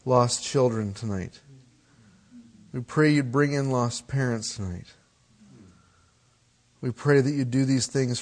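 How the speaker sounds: quiet, earnest prayer voice; hushed closing prayer. Acted. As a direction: pray softly to the Father. Pastor leads a prayer.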